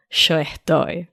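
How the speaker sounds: yo estoy said sho estoy, Argentine sh; Argentine accent. Shown as a demonstration. Host shows the Argentine version to listeners, Argentine accent. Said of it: "Argentine over here".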